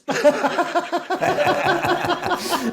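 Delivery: long, high run of giggles; high, rapid chuckling; laughing. Real, not acted. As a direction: laugh it out at your own joke. Laughs at own joke.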